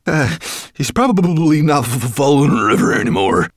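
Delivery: loud stuttering dwarf; cold stammer. A narrator's reading. Wet dwarf venturing a guess to master, stammering. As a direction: stumble over the f as you shiver.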